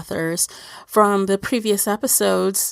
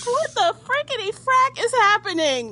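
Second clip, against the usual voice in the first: much higher, squealing mock outrage; hammed-up shocked reaction to the scene; mock disbelief; 14 semitones higher than usual.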